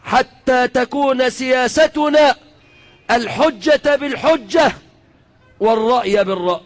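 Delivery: measured, drawn-out classical Arabic address; rallying speech from the pulpit, each phrase set apart; formal oratory. Acted. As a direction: proclaim it to the nation in stately phrases.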